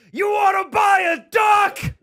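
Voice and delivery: shouted high and slow right in his face; screaming punchline. Retold or imitated. A stranger screams his duck joke in the comedian's face, slow high shout. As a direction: scream the punchline from five inches away. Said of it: "He's just screaming, spitting".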